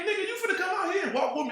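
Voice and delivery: high, fast annoyed yell at his friend; irritated shout. Retold or imitated. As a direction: bark it out, fed up and laughing. Angry quote to Jay.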